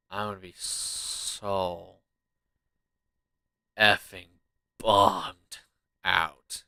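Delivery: drawn-out. Real, not acted. Drawn out complaint.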